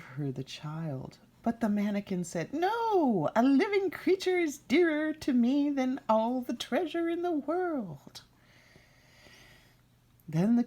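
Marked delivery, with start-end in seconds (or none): 2.5-8.2 high squeaky demand from an imp; high impish demand; little man's voice. Acted. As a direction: claim the prize in a goblin squeak. Little man refuses.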